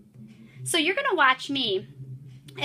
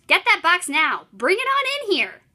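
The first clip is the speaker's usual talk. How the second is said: higher and louder, bossy orders; impatient and bossy; 6 semitones higher, 6 dB louder than usual. Shown as a demonstration. Teacher voicing Paul ordering his brother, higher and bossy. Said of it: "making my voice sound a little impatient and bossy".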